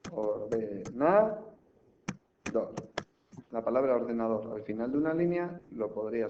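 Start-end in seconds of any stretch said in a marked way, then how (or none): 0.1-1.4 slow, drawn-out pieces with a break before the last syllable; slow word division. Shown as a demonstration. Teacher showing one more way to divide it, slowly. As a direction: stretch the word and split off the ending. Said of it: "Y hay otra forma".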